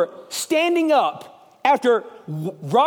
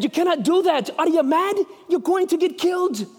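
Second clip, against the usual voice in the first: high, incredulous Scottish brogue; Scottish accent; 6 semitones higher than usual. Acted. Men warning Wallace he is mad, high Scottish accent. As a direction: pitch up the amateur Scots. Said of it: "It's not bad for a redneck".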